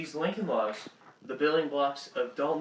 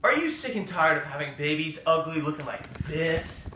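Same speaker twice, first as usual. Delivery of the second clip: ordinary delivery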